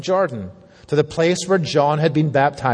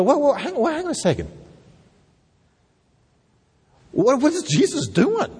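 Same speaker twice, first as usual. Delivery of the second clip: high-pitched, baffled stop-and-question; mock bewilderment; 9 semitones higher than usual. Puzzled readers interrupting over what Jesus said, acted bewilderment. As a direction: halt everything in acted confusion. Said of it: "And we wanna go".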